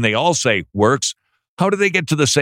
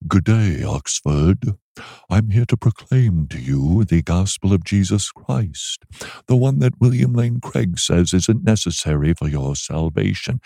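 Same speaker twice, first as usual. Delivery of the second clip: put-on much lower, haughty British accent; snooty British accent; 6 semitones lower than usual. An American preacher greeting Oxford in a mock snooty British accent. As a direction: play a stuck-up Brit greeting Oxford. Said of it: "as if I were British with a really snooty attitude".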